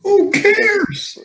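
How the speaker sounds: very high, drawn-out heckle; high-pitched scornful outburst; dismissive shout. Real, not acted. Heckles the influence point.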